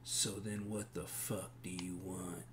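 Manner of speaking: quiet, menacing tough-guy voice; surly bar patron. Acted. Stranger at the bar confronts whoever touched him, quietly hostile. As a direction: grumble a challenge under the breath.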